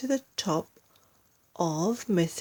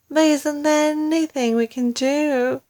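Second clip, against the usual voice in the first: high, louder frightened plea; cried; 8 semitones higher, 4 dB louder than usual. Acted. Wife begs husband for a cure, high frightened plea. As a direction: plead for a way out, high. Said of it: "cried Missus Twit".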